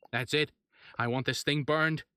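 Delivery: loud furious order; mayor shouting at the men to burn the creature. Acted. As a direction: put your foot down and shout it. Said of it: "the mayor shouted".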